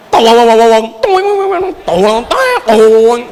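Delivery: hammed-up pleased tittering; preacher lapping up praise, giggling; mock giggling. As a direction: fake a flattered laugh. Mocking the flattered preacher's laugh.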